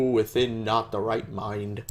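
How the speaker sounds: ordinary delivery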